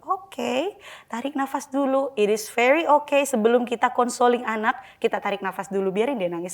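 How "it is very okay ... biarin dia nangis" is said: ordinary talk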